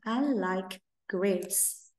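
put-on gentle repeat for kids; very slow soft model. Shown as a demonstration. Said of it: "Ví dụ như".

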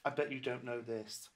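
ordinary delivery, nothing marked

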